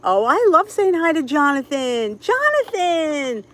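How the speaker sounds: high, loud, the name stretched out; gush, then draw the name out high; gushing greeting. Real, not acted. Delighting in saying hi to Jonathan, gushing. Gushes greeting to favorite viewer.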